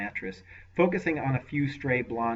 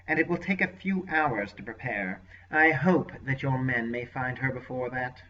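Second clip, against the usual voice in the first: raised, lightly oily sorcerer voice; voiced sorcerer; 4 semitones higher, 6 dB louder than usual. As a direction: play the scheming sorcerer, mild.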